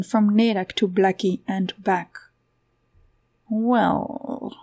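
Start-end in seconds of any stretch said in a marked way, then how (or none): 3.5-4.5 drawn-out, teasing purr; lightly flirtatious purr. A narrator's reading. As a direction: purr it suggestively. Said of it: "she purred".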